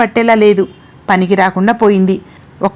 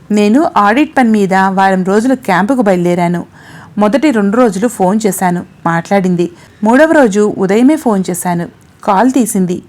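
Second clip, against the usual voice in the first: ordinary delivery, nothing marked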